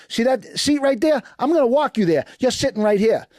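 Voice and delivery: a hint of a bossy warden giving orders. Warden seating inmates in the chow hall, lightly imitated as bossy. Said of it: "the warden was like".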